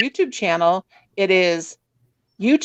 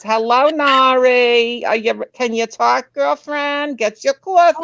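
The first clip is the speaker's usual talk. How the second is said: drawn-out, higher Bronx accent imitation; hammed-up Bronx accent; Linda; 3 semitones higher, slower than usual. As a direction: mimic a Bronx friend's singsong morning greeting. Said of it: "she's Bronx".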